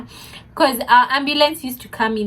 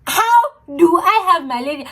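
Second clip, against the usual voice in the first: higher, louder disbelieving question; incredulous; 6 semitones higher, 3 dB louder than usual. Retold or imitated. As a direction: press harder in disbelief. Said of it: "repeats with more disbelief".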